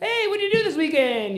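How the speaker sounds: lightly voiced chirpy colleague; cheery coworker. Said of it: "your friends at work are like".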